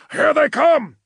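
high shout over gunfire. A narrator's reading. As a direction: yell above the roar of guns. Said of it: "Zen had to shout".

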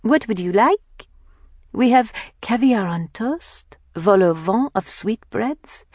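put-on high, lilting French-accented waiter. A narrator's reading. Kathleen greets her sisters at an imagined restaurant, French accent. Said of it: "greets them in a French accent".

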